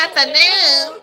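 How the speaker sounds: high, drawn-out mimicry; mock echo. Host echoing a played speech, high mocking mimicry.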